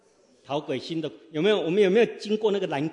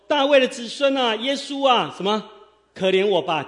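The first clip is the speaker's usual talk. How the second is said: blind man crying out; 5 semitones higher, 6 dB louder than usual. Acted. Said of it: "就喊着说".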